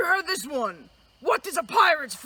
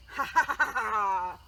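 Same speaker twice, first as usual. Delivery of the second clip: a slow, hammed-up pirate laugh, ha ha ha; 6 semitones lower, 6 dB quieter, slower than usual. Pirate gloating over found treasure, slow hammed-up laugh.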